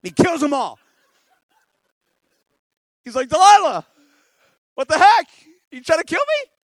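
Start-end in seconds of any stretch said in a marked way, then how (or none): 3.3-6.5 high, loud mock outrage; outraged confrontation. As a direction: yell at her in hammed-up disbelief. Samson confronts Delilah over betrayal.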